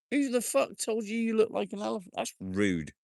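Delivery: very high, indignant squeal; mock outrage. Real, not acted. Streamer reacting to a rude viewer comment, high mock outrage. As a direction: act shocked at the insult.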